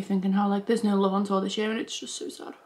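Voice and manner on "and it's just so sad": sad whisper. Real, not acted. Sad about concert ending.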